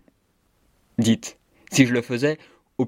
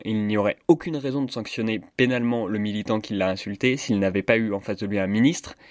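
ordinary talk

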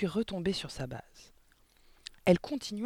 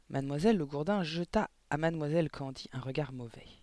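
plain reading voice

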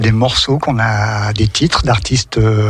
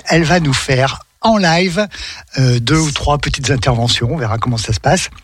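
ordinary talk, nothing marked

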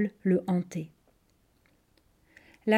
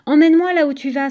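put-on loud, fast, higher-pitched child's plea; excited pleading; 5 semitones higher, 7 dB louder, faster than usual. A narrator's reading. Phèdre blocks her big sister's path, pleading excitedly. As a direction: beg excitedly to come along. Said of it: "supplia-t-elle tout excitée".

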